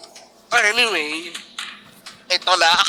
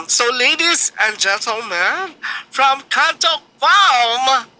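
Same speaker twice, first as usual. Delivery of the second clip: louder, slow, drawn-out announcer voice; showman announcer intro; 5 semitones higher, 6 dB louder, much slower than usual. Acted. Host presenting the next contestant to judges, grand announcer voice. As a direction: build it up like a stage emcee.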